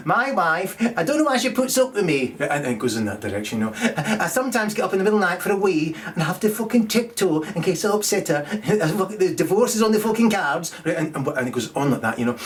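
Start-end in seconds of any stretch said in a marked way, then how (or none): 0.1-2.4 higher, meek mock comedian voice; mocking a poor comedian. Mocking poor comedians for the audience, meek and higher. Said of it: "really poor comedians will say things like". 4.0-10.7 higher, meek mock comedian voice; mocking a poor comedian. Mocking poor comedians for the audience, meek and higher. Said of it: "really poor comedians will say things like".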